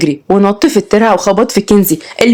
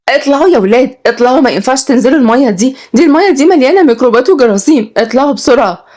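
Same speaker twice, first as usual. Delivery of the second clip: put-on high, fretful warning to children; tense teacher urging kids out; anxious, pitched-up scolding; nervous; 6 semitones higher than usual. A narrator's reading. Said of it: "ماسكة نقابها بتوتر وبتقول".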